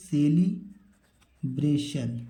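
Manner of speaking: Celebration spoken slowly, each syllable clear; careful slow teacher pronunciation; slow model word. Shown as a demonstration. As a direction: draw out celebration for the pupils. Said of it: "इसको क्या पढ़ेंगे?".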